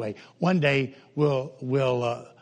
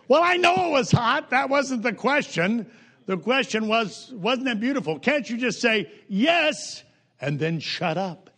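exasperated outburst; 8 semitones higher than usual. Acted. Preacher mocking a griping friend, exasperated. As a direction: throw up your hands at the friend.

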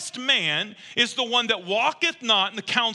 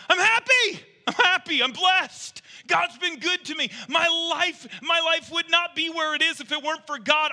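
exclamation-point happy; 10 semitones higher than usual. Acted. Psalmist voiced by the pastor, exclaiming he's blessed. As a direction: proclaim it like an all-caps shout of blessing. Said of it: "He's saying".

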